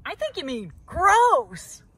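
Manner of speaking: loud, slow, sneering. A narrator's reading. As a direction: drag out the scorn. Bird sneers at grass.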